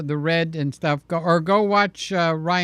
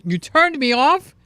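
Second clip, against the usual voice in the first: high, loud mock wounded gasp; mock shocked cry; 10 semitones higher, 6 dB louder than usual. Teasing Sly, pretending her words wounded him. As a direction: ham up being hurt.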